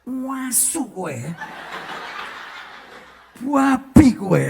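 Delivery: mock furious Javanese swearing; angry Javanese curse. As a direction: spit out the insults slowly. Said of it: "Orang Jawa kalau marah itu".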